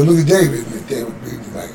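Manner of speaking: ordinary talk, nothing marked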